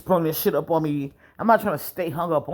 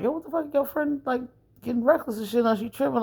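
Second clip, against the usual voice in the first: high, worked-up complaint; indignant; 9 semitones higher than usual. Retold or imitated. To his date about her reckless friend, high and indignant. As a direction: complain hotly, pitched way up.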